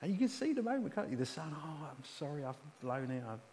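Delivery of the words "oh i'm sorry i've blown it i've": quiet, mildly acted apology; subdued confession. Wayward son confessing to his father, quiet and apologetic. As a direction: own up softly, as the wayward son. Acting the returning son's confession.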